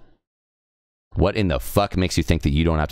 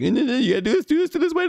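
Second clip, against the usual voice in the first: much higher mock whine of a backseat gamer; mocking backseater; faster than usual. Streamer imitates chatters telling him how to play, high mock whine. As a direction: squeak it like a nagging know-it-all. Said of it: "it's like".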